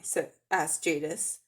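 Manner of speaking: ordinary delivery, nothing marked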